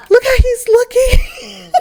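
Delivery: high, slow, squealing with laughter; real laughter. Real, not acted. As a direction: laugh through the words. Player laughs at character's face.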